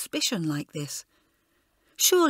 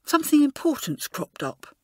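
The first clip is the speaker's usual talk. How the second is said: higher, uneasy warning; Jenny warning Elizabeth, uneasy and higher; unhappy; 7 semitones higher, 3 dB louder than usual. A narrator's reading. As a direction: say it unhappily, pitched up. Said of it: "she asked unhappily".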